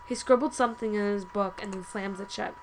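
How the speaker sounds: ordinary talk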